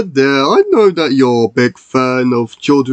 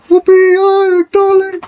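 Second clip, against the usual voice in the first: much higher squeaky toy voice; high character voice; 5 dB louder than usual. Acted. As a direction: voice a little high-pitched friend. Same high character cheers.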